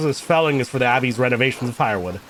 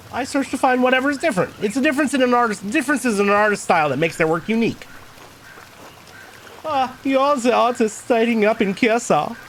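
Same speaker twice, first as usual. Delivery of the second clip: put-on higher-pitched German-accented character; 10 semitones higher than usual. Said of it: "went for the German accent with this guy".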